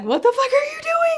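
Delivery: very high, laughing disbelief. Retold or imitated. Admin friends asking what she's doing, laughing high. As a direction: squeal it through a laugh. Friends react to her cheering.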